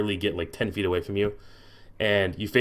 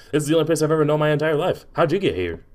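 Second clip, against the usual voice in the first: higher, quick fox voice; fast, raised fox character voice; talking fox; 7 semitones higher, 4 dB louder, faster than usual. Acted. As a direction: gush curiously as a naive fox.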